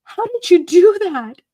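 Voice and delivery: high, loud astonished questions; amazed disbelief. Retold or imitated. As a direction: retell her stunned look at the work. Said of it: "she's looking at it going".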